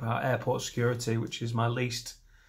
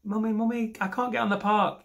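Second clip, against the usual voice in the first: put-on high pleading child's whine; mock a little kid begging his mum; squeaky childish wail; whiny kid voice; 8 semitones higher than usual.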